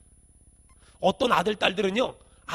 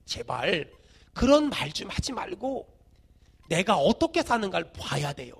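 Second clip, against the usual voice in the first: ordinary talk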